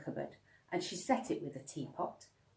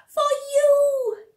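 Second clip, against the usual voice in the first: put-on very high, loud toddler offering; a proud little one giving a gift; beaming toddler; 16 dB louder than usual.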